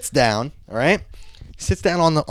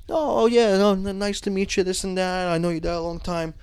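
higher, even, softening small talk; retold polite greeting; 6 semitones higher than usual. Older coworker greeting the boss's son, retold polite and higher. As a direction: imitate an older man's pleasantries, pitched up.

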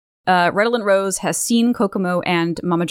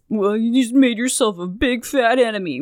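Mamajaru; 3 semitones higher than usual. Acted. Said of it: "Mamajaru backs down and says".

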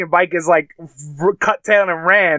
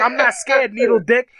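raised-pitch mock yell, held at talking volume; retold screaming; 7 semitones higher than usual. Beaten daughter taunting her attacker, mock screaming. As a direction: taunt like a mild imitation scream. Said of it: "she's fucking screaming at him".

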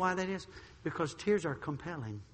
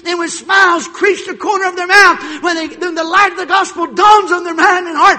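shouted, much higher and louder preaching; full-force preaching; 11 semitones higher, 17 dB louder than usual. Acted. Preacher shouting about hell and salvation. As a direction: thunder it out from the pulpit.

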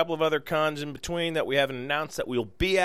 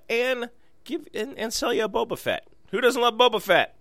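high excited voice; 9 semitones higher than usual. Real, not acted. Excited plug for print.

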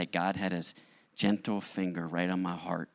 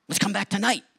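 eager; 12 semitones higher, 6 dB louder than usual. Retold or imitated. Eager plea to wife.

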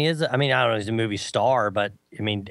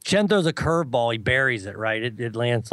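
ordinary talk

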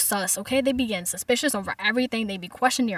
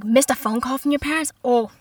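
ordinary delivery

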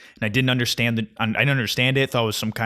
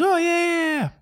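put-on squeaky, much higher girl at the gym; mock girl voice; 3 dB louder than usual. Imitating the gym girl's breezy yeah in a high voice. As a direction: jump way up high to mimic her. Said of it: "She goes".

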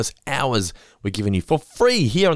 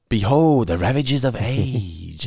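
slow, grand mock-spooky proclamation; ghost train showman; much slower than usual. Ghost train host talking up the ride, slow hammy voice. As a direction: intone it like a carnival barker. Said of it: "the guy talks it up".

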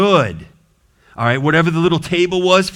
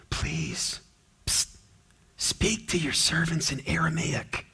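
much softer, hushed begging; hushed plea; 9 dB quieter than usual. Retold or imitated. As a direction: plead quietly so the wall can't hear. Envoys begging to speak privately.

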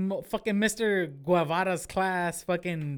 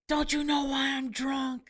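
higher, slow dramatic movie line; mock drunk movie son; 6 semitones higher than usual. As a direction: ham it up like a sad film scene. Movie scene parody, drunk son.

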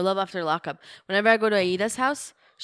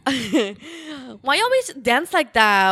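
high, loud, slow Puerto Rican voice; sassy Puerto Rican woman impression; Puerto Rican accent; 7 semitones higher, 8 dB louder, slower than usual. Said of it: "Puerto Rican?".

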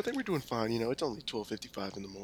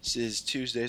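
usual speaking voice

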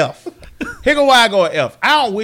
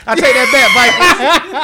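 shouted while laughing; laughing take-back; 4 semitones higher, 6 dB louder, much slower than usual. Real, not acted. Owning the joke to his friend, laughing shout. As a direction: laugh out the retraction.

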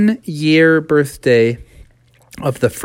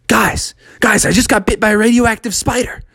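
higher, fast excited telling; 7 semitones higher than usual. Acted. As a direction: burst out the news to friends. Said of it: "Froggy started to get excited".

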